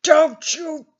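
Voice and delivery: mock cop shout; cop yelling. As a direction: snap the order. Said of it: "shotgun pointed at your head".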